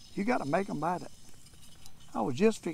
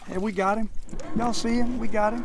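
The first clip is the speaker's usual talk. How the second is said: real joy at the catch; excited; 5 semitones higher than usual. Real, not acted. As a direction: show it off to viewers. Landing the fish.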